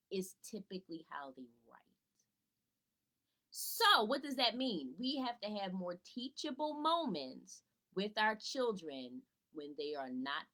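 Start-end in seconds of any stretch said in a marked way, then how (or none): none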